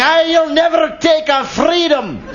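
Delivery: hammed-up freedom shout through laughter; mock battle cry. Host imitates painted Scots shouting at Siri, laughing mock battle cry. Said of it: "they're shouting".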